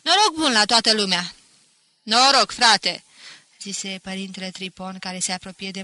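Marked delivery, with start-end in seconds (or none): 0.0-1.3 booming good cheer to all; loud hearty greeting. A narrator's reading. Pinto salutes everyone in the tavern, loud and hearty. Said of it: "spuse în gura mare". 2.0-3.0 loud, brotherly hail; hearty loud greeting. A narrator's reading. Priest comes to embrace Pinto, loud and hearty.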